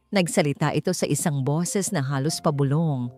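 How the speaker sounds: plain reading voice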